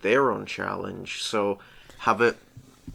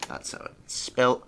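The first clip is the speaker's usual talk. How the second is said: ordinary delivery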